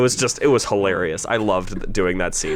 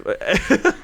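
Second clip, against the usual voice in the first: laugh; 9 semitones higher than usual. Real, not acted. Laughing burst in commentary.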